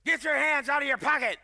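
a hint of a rough, barked command; Mahesh Shavda. Guest preacher ordering him from the stage, lightly imitated rough voice. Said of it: "he's got a real rough voice".

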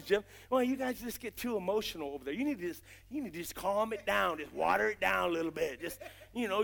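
softly voiced disapproving churchgoer; a mild, hushed imitation of a critic; mock critic. Preacher mimicking a critic telling him to calm down, hushed. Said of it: "said to me so many times".

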